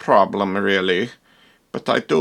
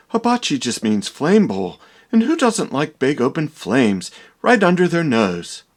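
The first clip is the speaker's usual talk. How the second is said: normal talking voice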